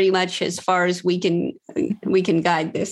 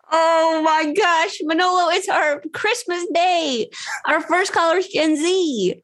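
excited; 8 semitones higher than usual. Real, not acted. Thrilled host, first Gen Z caller.

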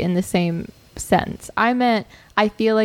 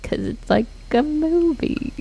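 slow, higher-pitched mock thinking aloud; 7 semitones higher, slower than usual. Imitating an actor in an interview, slow and groping. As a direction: imitate a star hunting for words, dragging them out. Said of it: "it's like he's trying to think".

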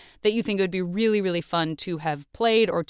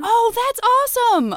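high, loud delighted oh; thrilled to hear it; pleasantly surprised oh; delighted surprise; 7 dB louder than usual. Real, not acted. Delighted reaction to answer.

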